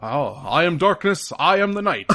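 high, loud hammed-up Batman. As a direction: declare it like a pompous hero. Hammy Batman line.

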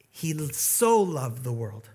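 "so" slowed and held; stress on so. Shown as a demonstration. Pastor showing what the verse really says, slow stress on "so". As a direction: draw out "so" in "so loved the world". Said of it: "It says".

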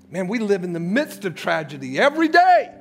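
ordinary talk

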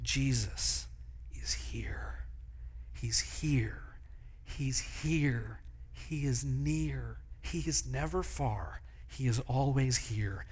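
soft whisper repeating a promise; whispered mantra. Shown as a demonstration. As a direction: breathe it like a secret prayer. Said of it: "just to do this".

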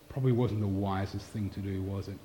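cold quiet disappointment. Retold or imitated. Pastor quietly rebuking the boy who smashed the cups, cold and soft. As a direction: let the disappointment sit quietly in the question. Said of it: "Pastor Charles's cold, quiet disappointment".

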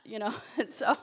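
ordinary talk, nothing marked

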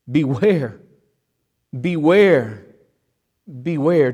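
BEWARE said three times, each set apart by a pause; emphatic warning. Acted. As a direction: hammer BEWARE as a separate, falling warning. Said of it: "do you think he's being emphatic".

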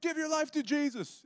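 high, loud street-evangelist holler; imitated yelling. Imitating Chris yelling to people from the car, high holler. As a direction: yell it like out of a car window. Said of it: "and he's yelling".

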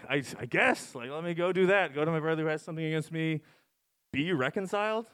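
usual speaking voice

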